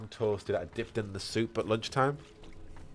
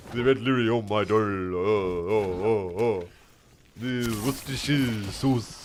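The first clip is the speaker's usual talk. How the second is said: put-on a light French-style Orlesian bard accent, louder; 6 dB louder than usual. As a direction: croon it like a hammed-up Orlesian bard. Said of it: "He's used to saying words like".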